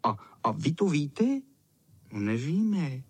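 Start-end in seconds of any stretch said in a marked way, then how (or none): 2.1-3.0 a touch of glum admission; understated, downcast reply; even sadder. A narrator's reading. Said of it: "jen smutněji řekli oni". (trochu smutně)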